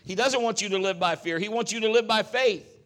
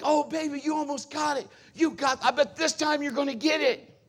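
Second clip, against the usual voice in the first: encouraging parent; 7 semitones higher, 4 dB louder than usual. Retold or imitated. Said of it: "We like".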